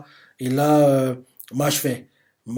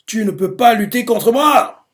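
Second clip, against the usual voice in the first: slow, high and loud menacing taunt; villain threat; 7 semitones higher, 6 dB louder than usual. Retold or imitated. Domina taunting Mash, slow loud villain threat. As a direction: spit the threat down at him, slowly.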